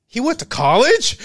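hammed-up shock that he went to college; shocked question. Guy shocked at news.

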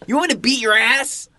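high, hammed-up threat; a joking bully voice; mock tough threat.

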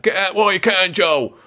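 imitating fans booing at the station; mock jeering fans. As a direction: jeer and boo like a hostile crowd, half in fun.

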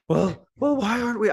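high, abandoned cartoon pig voice; a quick high try at a tiny pig; Piglet voice. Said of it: "I can't do a fucking Piglet voice".

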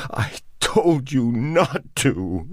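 high, slow, anguished cry. A narrator's reading. Ruth cries out while striking Robert, high and anguished. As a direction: wail it as you strike. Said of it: "she cried".

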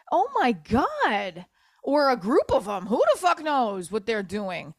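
high, drawn-out incredulous rant; exasperated outburst. Real, not acted. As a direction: let real exasperation swing the pitch up. Venting about his photo with women.

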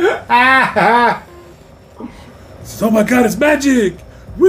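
high mock audience cheer; excited cheer. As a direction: cheer like a fan. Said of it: "everybody's like".

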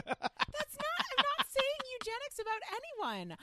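high-pitched, indignant denial; flustered protest. Real, not acted. Protests a teasing eugenics accusation.

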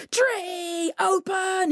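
high, drawn-out command; crying out. A narrator's reading. Coyote ordering the tree to open, high crying out. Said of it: "he cried".

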